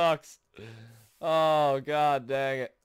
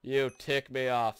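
ordinary talk, nothing marked

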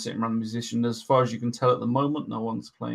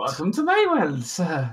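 barmaid; 4 semitones higher, 4 dB louder, slower than usual. Acted. Barmaid teases the player.

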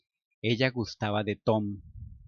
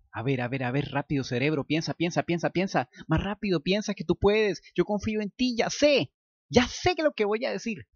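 starts level and climbs ever higher and more frantic; mounting inner panic; 6 semitones higher than usual. Acted. Luke begging his own brain, panic climbing higher. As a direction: coax his own brain, getting more desperate by the line.

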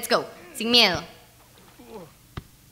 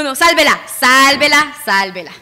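loud, insistent repeated cry; urgent shout; 2 semitones higher, 11 dB louder than usual. Real, not acted. Player urging Ricky to save it, urgent shout. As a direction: belt it out urgently, twice.

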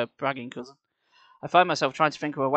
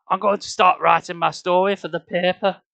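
teasing woman; 4 semitones higher, 6 dB louder than usual. Acted. Nyla's voice.

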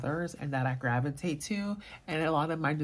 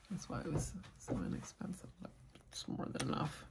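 soft unvoiced whisper; quiet whispered aside while smelling; 2 semitones higher, 11 dB quieter than usual. Real, not acted. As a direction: hush it to a breathy aside. Quiet aside while sniffing perfume.

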